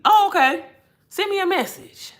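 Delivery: high, bright surprise; surprised. Real, not acted. Answering a viewer in live chat, surprised. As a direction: react suddenly to a chat comment.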